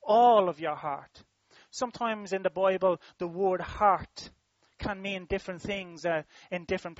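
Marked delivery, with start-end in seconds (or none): 0.0-1.2 "All" drawn out and weighted, then falling away; a low, deliberate emphasis on "All"; stress on ALL. Shown as a demonstration. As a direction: lean hard and long on "All". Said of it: "The emphasis is on all".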